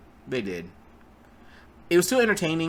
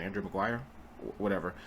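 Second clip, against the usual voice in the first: "Andrew Maguire" said softer, trailing off in doubt; "Andrew Maguire" tailing off as he realises it is wrong; unsure mumble; 5 semitones lower, 9 dB quieter, faster than usual. Real, not acted. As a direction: mumble the wrong name as if catching the mistake. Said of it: "Andrew Garfield".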